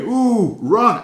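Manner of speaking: high, loud mock cry of alarm; hammed-up, swooping warning cry; acted alarm. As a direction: play-act spotting a storm and urging escape. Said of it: "the sky is starting to fall in".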